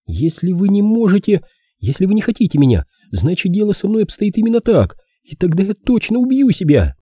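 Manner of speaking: put-on high-pitched insistent girl; young woman character. Girl threatens herself to sway the detective, high desperate insistence.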